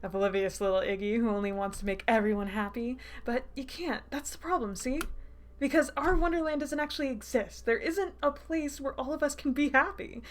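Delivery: taunting villain. Acted. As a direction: toy with a cornered victim in theatrical pity.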